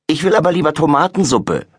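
put-on girl protesting to dad. A narrator's reading. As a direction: push back against her brother. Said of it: "protestiert Laura".

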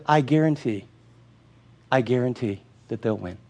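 low, soft, sure promise; a father knowing the ending; reassuring dad. Retold or imitated. Dad promising his son the team will win, low and calm.

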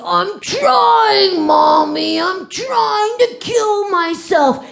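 high, loud mock wail of a pretend sob; imitating a child hamming up tears, high and loud; fake crying child. Child pretending to cry to his mommy, loud mock wail. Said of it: "he pretends to cry".

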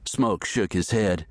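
plain reading voice

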